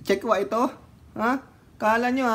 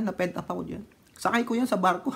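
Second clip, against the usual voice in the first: ordinary talk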